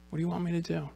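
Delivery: hushed, slightly lifted, intimate asking; soft question. Acted. Jesus asks the blind man near him, softly. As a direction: ask it very softly, as if to one person.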